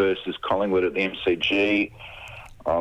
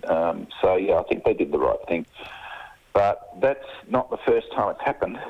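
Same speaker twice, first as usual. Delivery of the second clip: normal talking voice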